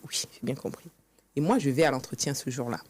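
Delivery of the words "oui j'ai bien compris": a meek, whispered acknowledgement; barely-voiced, obedient reply; timid whispered yes. Retold obedient answer to the briefing.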